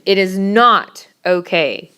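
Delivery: slow, emphatic "not" to make the point; inflection on not. Shown as a demonstration. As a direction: lean hard on "not", slowly. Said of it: "That's inflection".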